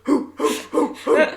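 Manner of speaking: jock hoots from the back; lightly voiced whooping buddies; mock hooting. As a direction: hoot like leering friends. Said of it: "his friends in the back going".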